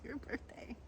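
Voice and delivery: breathy laughing words; unvoiced laughing delivery. Real, not acted. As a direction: say it through a laugh. Laughing, half-mocking child's grievance.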